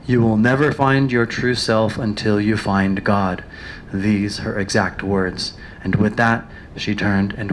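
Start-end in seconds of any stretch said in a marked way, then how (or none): none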